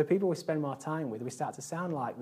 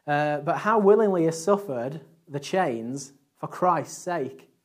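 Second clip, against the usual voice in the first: slow, loud and higher, declaiming; preaching at full force; 5 semitones higher, 9 dB louder, slower than usual. Acted. Preacher to congregation about Paul in chains, loud and slow. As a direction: thunder it out like a sermon climax.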